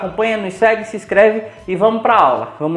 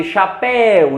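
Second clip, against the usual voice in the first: chapéu with a long, stressed "péu"; sílaba tônica destacada; much slower than usual. Shown as a demonstration. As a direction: stress the last syllable of chapéu, drawn out. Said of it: "a mais forte, né? A última". (chapéu com a última sílaba forte e alongada)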